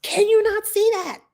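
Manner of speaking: high, pleading exasperation; high-pitched frustrated outburst at oblivious parents; exasperated cry. Retold or imitated. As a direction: throw it at them in disbelief. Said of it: "And I'm like".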